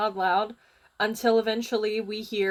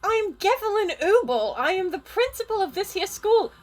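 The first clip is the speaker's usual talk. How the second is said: high-pitched, affronted authority; indignant principal; 9 semitones higher, 3 dB louder than usual. Acted. Principal introduces herself to intruder, indignant high voice. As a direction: announce yourself high and demand an explanation.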